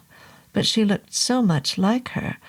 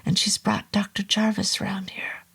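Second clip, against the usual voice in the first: urgent breathy hiss; hissed whisper; 2 semitones higher, 4 dB quieter than usual. Acted. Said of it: "I hissed".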